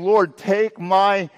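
urgent cry of trust, pitched high; earnest plea to God. Acted. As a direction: plead upward with conviction. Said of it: "It's saying".

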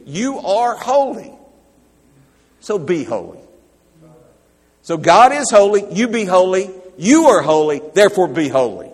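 high, loud, driving sermon voice; fervent preaching. Acted. Pastor urging his congregation to be holy, fervent preaching. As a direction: preach it full force, pressing each line home.

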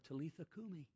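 put-on whisper-soft to a child; softly tender Aramaic; tender hush. Jesus speaks tenderly to girl.

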